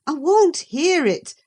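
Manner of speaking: put-on old servant refusing to hear the dream, loud; hasty refusal. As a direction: refuse quickly and loudly. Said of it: "I repeated hastily".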